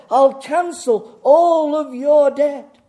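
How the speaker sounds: slow, high and very loud proclamation; big booming announcement. Acted. Preacher voicing a bank's dream offer to congregation, booming proclamation. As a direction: draw out the offer at full volume.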